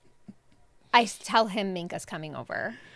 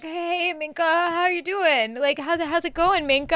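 higher-pitched mock-suave greeting; acting all cool; 6 semitones higher than usual. Mom imitates son greeting his crush, acting all cool. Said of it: "like, acting all cool".

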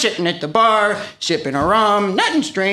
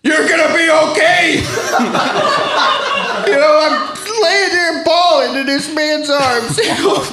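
high, cracking-up emotional confession; hammed-up weepy pleading; tearful outpouring; 5 semitones higher than usual. Guest tells host of being held by a man, tearfully.

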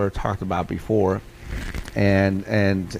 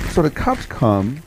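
ordinary delivery

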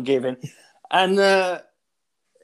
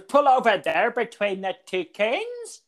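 mock Northern Ireland accent; 6 semitones higher, slower than usual. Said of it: "speaking in a Northern Ireland accent".

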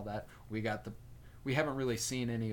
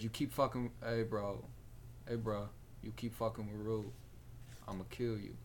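Fezco; 5 dB quieter than usual. Acted. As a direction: threaten softly, without raising the voice. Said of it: "And that's how he sounded".

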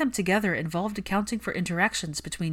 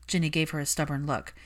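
plain reading voice

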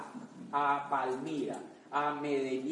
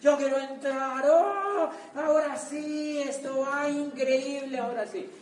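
mock eager crowd; 8 semitones higher, 4 dB louder than usual. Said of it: "se quedaron como trescientas por fuera".

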